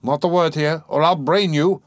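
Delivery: fierce warning, mildly furious. Acted. Razumihin seizing his friend by the shoulder, furiously warning. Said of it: "Razumihin whispered furiously".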